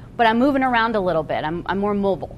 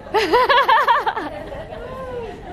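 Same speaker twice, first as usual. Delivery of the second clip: giggle; 11 semitones higher than usual. Real, not acted. Teasing the only man.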